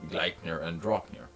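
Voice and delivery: ordinary talk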